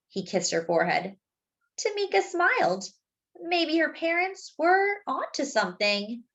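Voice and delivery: put-on gentle read-aloud for children; story narration. A narrator's reading. Narrator reading a story to young listeners, gently. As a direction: tell it like a bedtime picture book. Reads the picture book aloud.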